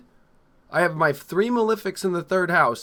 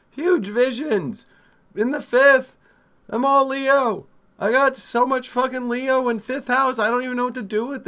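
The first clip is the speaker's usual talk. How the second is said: louder and higher, fired up; excited; 8 semitones higher, 4 dB louder than usual. Real, not acted. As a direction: brag about it, buzzing. Enthusing about her chart.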